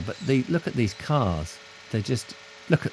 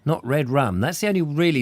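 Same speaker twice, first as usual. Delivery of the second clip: normal talking voice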